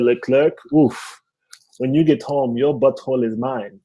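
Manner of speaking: mock threat in a Haitian accent; mom's thick Haitian accent. Mom threatening her son, thick Haitian accent. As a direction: imitate a Haitian-accented mother trying out an idiom. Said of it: "my mom has a very thick accent".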